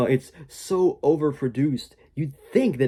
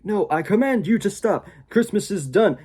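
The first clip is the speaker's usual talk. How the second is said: higher, louder villain command; witch commanding; 9 semitones higher, 5 dB louder than usual. Retold or imitated. The witch ordering the village to stop Christmas, higher and louder. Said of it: "the witch is like".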